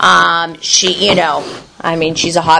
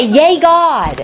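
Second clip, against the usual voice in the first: cheerful cheer; 6 semitones higher than usual. Retold or imitated. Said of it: "And she would say".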